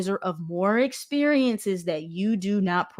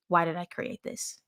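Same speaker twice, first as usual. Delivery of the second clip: softly repeated question, evenly said; quiet reflection; 2 semitones lower, 8 dB quieter than usual. Retold or imitated. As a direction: drop the volume, as if asking yourself.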